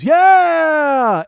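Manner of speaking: very high, loud cheering yeah; crowd cheer. Retold or imitated. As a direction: roar like a packed room greeting him.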